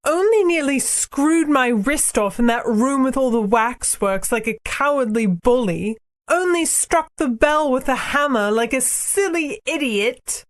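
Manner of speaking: put-on high girl's voice, scornful; high-pitched, aggrieved sarcasm; sarcastic Polly. A narrator's reading. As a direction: list his crimes sarcastically in a high child voice. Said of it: "said Polly sarcastically".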